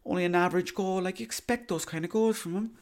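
very high-pitched mock football pundit; grudging verdict in a squeaky put-on voice; high, unimpressed caricature of a pundit; Roy Keane. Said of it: "Roy Keane go".

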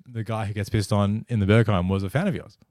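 ordinary delivery

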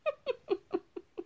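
high, airy burst of laughter. Real, not acted. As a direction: laugh out at the joke. Laughing at her own joke.

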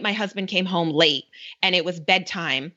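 ordinary delivery

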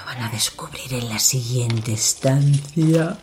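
slow, sinister presenter; creepy host voice. Acted. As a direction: draw out each word like a horror host. Creepy host speaking to audience.

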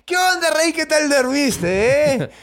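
high, very slow teasing greeting; cocky hero. Retold or imitated. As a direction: swagger in and needle the king.

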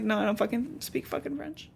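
put-on grumpy French accent. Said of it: "You literally have a French accent".

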